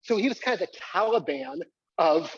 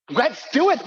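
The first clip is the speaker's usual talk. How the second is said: hyped; 6 semitones higher, 4 dB louder, slower than usual. Real, not acted. Hyping the audience.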